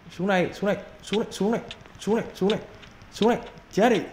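eager battle taunt; excited taunt. Real, not acted. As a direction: goad the enemy to come down. Taunting enemy during fight.

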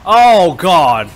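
loud sudden oh God; startled gasp. Real, not acted. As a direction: caught off guard. Startled by the scene.

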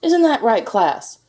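loud, high challenge to the class; yelling coach, pitch shooting up; coach's yell. A narrator's reading. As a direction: call on the class for backup, loudly. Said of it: "yelled the coach".

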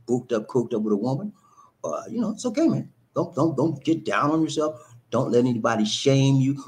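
ordinary talk, nothing marked

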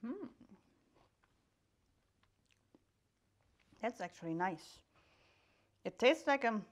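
chewing while talking; eating a snack; mouth partly full. Real, not acted. Seen 3: Snack held at lips, nibbling between phrases; she eats before and after.

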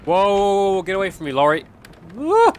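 jumpy, high startled cries of whoa; real fright at a jump scare; startled yelps. Real, not acted. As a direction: recoil from a sudden scare. Startled by toy lorry jump scare.

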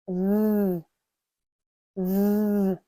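long voiced th, tongue between the teeth; sustained buzzing «З» with tongue between teeth; bee-like buzz. Shown as a demonstration. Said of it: "як бджілка".

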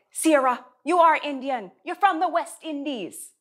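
put-on high, emphatic dad in a Caribbean accent gone German; botched Jamaican accent. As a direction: imitate a Jamaican father correcting his child, accent slipping towards German. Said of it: "I sounded German that time".

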